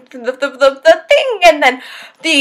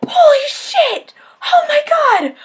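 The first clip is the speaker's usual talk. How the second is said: much higher, tearful and slow, ending in a shout; overwhelmed crying out; 13 semitones higher, 3 dB louder, much slower than usual. Real, not acted. Reviewer losing it over the son reveal, tearful high shout. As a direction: cry out like you can't contain it. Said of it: "I can't even breathe".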